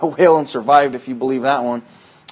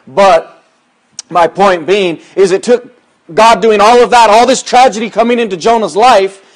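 raised, driving preacher voice; preaching; 5 semitones higher, 6 dB louder than usual. Acted. Pastor driving home Jonah's story to the church, preaching hard. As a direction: preach it out to the congregation, building. Preacher building to full force.

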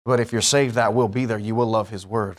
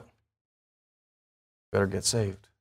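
drawn out low and soft; very slow, quiet emphasis on better; slow warning; 5 semitones lower, 7 dB quieter, much slower than usual. Acted. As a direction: let each word hang as a solemn warning. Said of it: "guess what?".

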